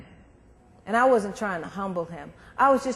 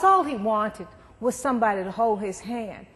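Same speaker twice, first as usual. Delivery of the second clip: usual speaking voice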